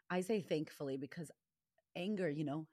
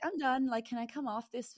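mock exasperated outburst; 8 semitones higher, 5 dB louder than usual. Retelling despair at twelve.

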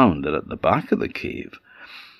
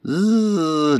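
high imitated buzzing; bumblebee buzz; 10 semitones higher than usual. Bee returns to the vixen, buzzing. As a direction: buzz before reassuring. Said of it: "it said to the vixen".

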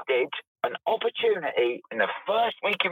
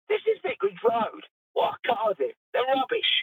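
indignant rant, pitched high; angry; 6 semitones higher than usual. Real, not acted. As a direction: vent real exasperation in a high voice. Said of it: "I'm sorry to get angry".

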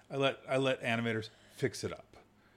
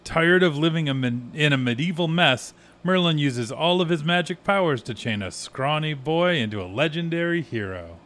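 movie trailer read; 4 semitones higher, 7 dB louder than usual. Acted. Reading poster blurb.

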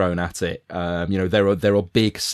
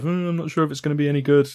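higher, quicker imitation of his own scepticism; doubtful mock quote; 6 semitones higher, faster than usual. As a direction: hem doubtfully, mimicking your earlier self. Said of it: "despite all my earlier sort of".

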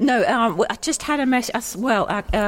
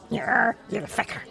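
light mock Irish grumble, kept soft; Irish accent; 2 semitones higher, 5 dB quieter than usual. Retelling a grumpy Irish neighbour scolding kids, mock Irish accent. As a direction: toss it off in a cheeky put-on Irish voice. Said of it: "They said it in an Irish accent".

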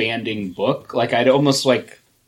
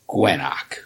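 lower, odd creature voice; Gwenok voice; 7 semitones lower than usual. Acted. Cohost voices Gwenok in the comic reading, in a lower, odd creature voice. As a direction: growl out the clone's name. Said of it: "A dramatic reading".

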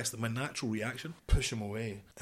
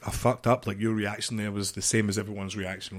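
ordinary talk

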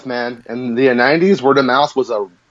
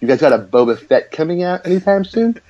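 normal talking voice

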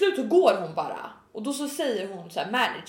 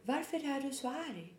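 much softer, higher-pitched voice; completely calm; 4 semitones higher, 12 dB quieter than usual. Retold or imitated. Manager to screaming customer, softly calm. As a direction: imitate an unruffled manager, very quietly. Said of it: "Managern är helt lugn".

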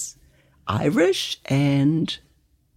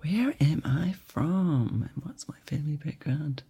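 to herself; 9 dB quieter than usual. Real, not acted. Guest mulls the question aloud.

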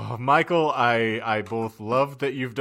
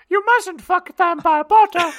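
very high, loud elf squeal; piercing little creature voice; Dobby; 8 dB louder, slower than usual. Acted. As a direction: squeak out a frantic house-elf warning. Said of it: "I'm more like Dobby".

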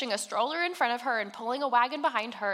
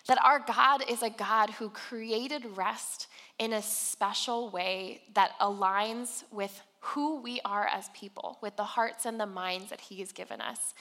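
usual speaking voice

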